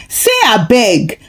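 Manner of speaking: ordinary delivery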